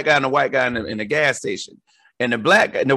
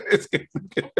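laughing; 6 dB quieter than usual. Retold or imitated.

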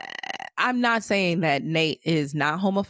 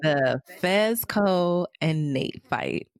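ordinary talk, nothing marked

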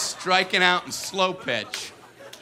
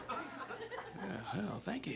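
muttered thanks; 18 dB quieter, much slower than usual. Retold or imitated. Sarcastic kid reply.

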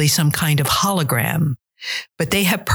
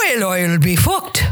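whale, oil, beef, hooked run together fast in an Irish accent; fast Irish lilt; 3 semitones higher than usual. Shown as a demonstration. Dialect coach shows the host the Irish trick, quick Irish accent. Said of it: "say it to yourself really fast".

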